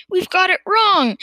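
high, loud outburst of dismay; dismayed cry. A narrator's reading. Farmer seeing the medicine has failed, crying out. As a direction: exclaim it as a disaster. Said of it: "cried Mister".

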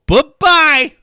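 high, loud sing-song taunt; mocking bye-bye. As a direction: wave it off with a taunt. Mocking the song's drop.

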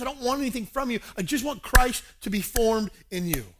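high, forceful preaching of a quote; impassioned insistence. Retold or imitated. Paul wanting Christ formed in church, impassioned. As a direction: insist fervently on their good. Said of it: "In this case here, he says".

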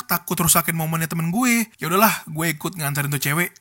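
usual speaking voice

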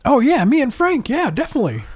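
a squeaky, high, excited burst; Charlie moment. Pretend. A host slips into a TV character, squeaky and excited. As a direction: blurt it up high like a manic sitcom guy. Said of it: "I had a Charlie moment".

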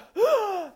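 sharp, high, loud intake of breath; sudden shocked gasp, demonstrated; startled gasp. Shown as a demonstration. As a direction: gasp as if a car just cut you off. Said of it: "that sudden, like".

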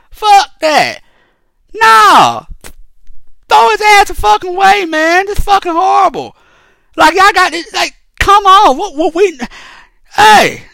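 high-pitched, heated outrage; fired-up ranting against the justice system; outraged rant. Real, not acted. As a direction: vent your disgust at the case, voice up high. Ranting furiously about the case.

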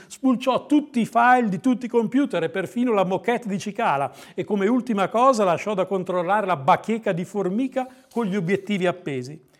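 ordinary delivery

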